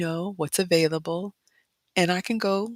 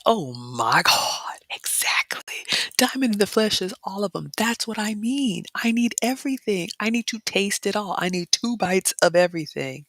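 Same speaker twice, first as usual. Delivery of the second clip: whispered craving; 5 semitones higher than usual. Real, not acted. Vlogger reading viewer comments about pastries, whispered craving. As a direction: whisper then higher eager voice.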